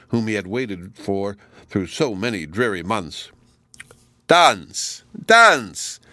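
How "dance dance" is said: loud, high, exuberant call with falling tone; joyous cry. A narrator's reading. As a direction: cry it out joyously, each word on its own. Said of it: "cried he joyously".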